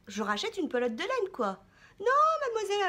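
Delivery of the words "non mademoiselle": mock indignation. Real, not acted. Mother mock-scolding daughter.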